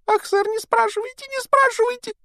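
high, squeaky pleading elf; house-elf voice. Acted. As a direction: play Dobby the anxious house-elf. Dobby begs. (тонкий голос домового эльфа)

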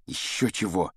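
muted, tight retort; through clenched teeth. A narrator's reading. Boy answering his rival's taunt, muted through clenched teeth. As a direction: force it out through gritted teeth. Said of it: "процедил Гарри сквозь зубы".

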